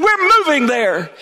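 high, slow, emphatic announcement; big reveal. Retold or imitated. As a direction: drop the news with a flourish. Dad revealing the move.

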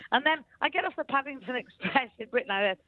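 usual speaking voice

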